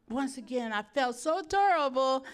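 normal talking voice

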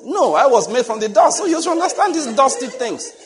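high, loud indignant comeback; husband shouting back at his wife, hammed-up; loud mock retort. Acted quarrel retort.

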